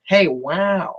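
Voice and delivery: lightly voiced amazed drawn-out wow; a hint of the actor's trademark wow; Owen Wilson. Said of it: "It was kinda like Owen Wilson".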